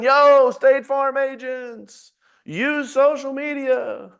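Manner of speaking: slow, loud proclamation from on high; shouting from rooftops. Retold or imitated. Coach yelling at State Farm agents from the rooftops, loud and slow. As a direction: call it out to everyone, drawn out. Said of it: "on the top of the rooftops and the mountaintops".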